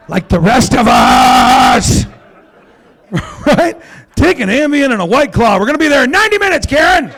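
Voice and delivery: loud, high snapping at a fussy flyer; mock exasperation. Fed-up traveller snapping at Karen, loud and high. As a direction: yell it like a fed-up passenger.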